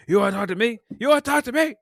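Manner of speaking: high, loud mock yell, building on the repeat; imitated screaming. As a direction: scream it like the raging movie cop. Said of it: "Yeah, he's just screaming".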